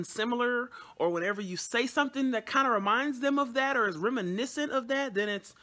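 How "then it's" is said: normal talking voice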